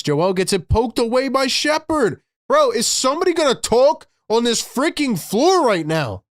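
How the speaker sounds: real fan anger at the players; furious. Real, not acted. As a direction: yell your frustration at the screen. Fan raging at own team.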